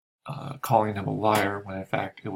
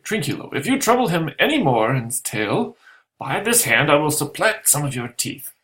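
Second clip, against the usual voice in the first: put-on higher, louder drunken threat; Stefano threatening; 6 semitones higher, 4 dB louder than usual. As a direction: warn him off with bluster.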